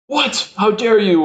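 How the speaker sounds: high-pitched imitation of indignation; hammed-up indignant protest; mock outrage. Acts out the tortured man's outrage.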